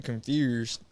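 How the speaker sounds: imitated Memphis accent on "Confused". Shown as a demonstration. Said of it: "They be like".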